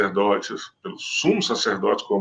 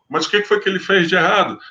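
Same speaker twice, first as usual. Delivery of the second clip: quick, higher, a hint of a puzzled governor; Pilate asking; 7 semitones higher, 4 dB louder, faster than usual. Pilate questioning the accusers, lightly puzzled. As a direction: fire the question back, lightly voiced.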